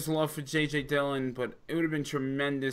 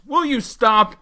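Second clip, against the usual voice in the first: higher, louder mock exasperation; exasperated Gorilla; 7 semitones higher, 9 dB louder, slower than usual. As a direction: play fed-up Gorilla cutting him off. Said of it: "it's like".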